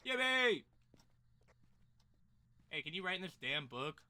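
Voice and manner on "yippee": loud, high cheer; excited cheer. Real, not acted. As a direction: whoop it out with joy. Cheering over the found book.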